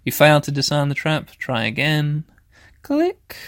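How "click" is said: squeaky click. Pretend. Clicking to disarm trap.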